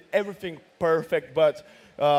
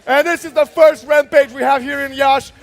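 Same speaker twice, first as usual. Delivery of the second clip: loud, drawn-out victory call; fighting game announcer; 9 semitones higher, 13 dB louder, slower than usual. Real, not acted. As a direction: call the win like a finale. Rampage call and wrap.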